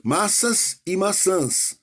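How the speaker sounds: slow, "Massas e maçãs" set apart word by word; teacher contrasting the sounds of two words. Shown as a demonstration. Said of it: "exceto a entonação".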